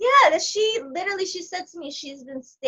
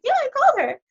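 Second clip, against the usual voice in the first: much higher, louder, breezy voice; mock assistant; 5 dB louder, faster than usual. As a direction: play the casual assistant way up high. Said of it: "She's like".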